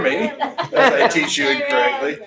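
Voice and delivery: high, loud laughing self-mockery. Real, not acted. Teacher owns up to a mistake to students, laughing loudly. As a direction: talk through a laugh at your own slip.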